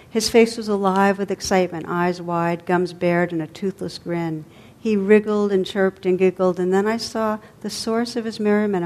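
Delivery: ordinary delivery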